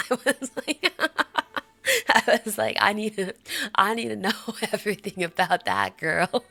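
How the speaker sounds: loud, giggly mock urgency; loud words broken by laughter; laughing retelling. Real, not acted. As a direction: laugh through the emphatic quote. Laughing as she retells falling for streamer.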